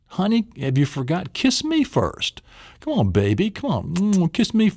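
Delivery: hammed-up sweet wife; wife asking for a kiss. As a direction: coax him into a kiss, teasing. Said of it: "She says".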